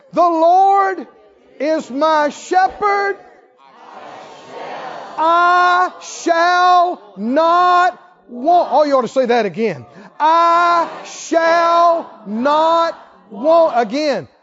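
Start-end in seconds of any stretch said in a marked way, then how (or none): 0.1-8.7 high, loud, very slow proclamation; emphatic proclamation. Shown as a demonstration. Preacher proclaims right version of psalm. 10.2-13.8 loud, high, drawn-out shout; emphatic proclamation. Shown as a demonstration. Proclaimed again for congregation.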